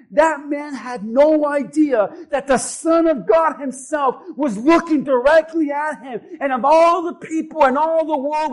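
building from fervent to a shouted, slowed climax; preaching at full force. Acted. Pastor preaching to the congregation, full force.

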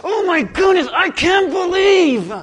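loud, high dismayed shout that slows at the end; stretched-out shout of disbelief; regretful outcry. Acted. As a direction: wail over a missed chance. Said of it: "don't you go".

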